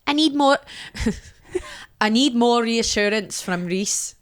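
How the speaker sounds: drawn, put-on pleading voice; contestant impression. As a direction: mimic her accent, amused. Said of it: "I wish I could do her accent".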